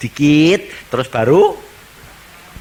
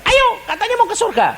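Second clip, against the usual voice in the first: teasing angel; faster than usual. Acted. Angel fetches the sinner.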